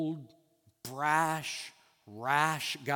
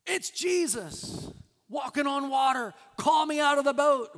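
eager Peter; 11 semitones higher, 7 dB louder than usual. Retold or imitated. Preacher playing Peter thrilled at Jesus on the water, eager shout. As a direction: call it out high and loud, full of eagerness.

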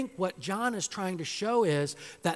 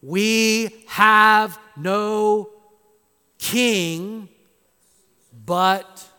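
loud, very slow, drawn-out declaration; crying out; 4 semitones higher, 12 dB louder, much slower than usual. Acted. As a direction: proclaim it like the chief priests. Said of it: "crying out".

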